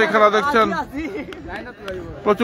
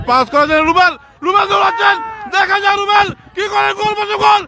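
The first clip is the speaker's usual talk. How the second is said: frantic football commentary; 7 semitones higher than usual. Real, not acted. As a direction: scream the build-up toward goal. Attack builds to goal.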